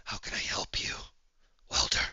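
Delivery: very soft, controlled whisper; calming down. Acted. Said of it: "he's, like, slowly coming down".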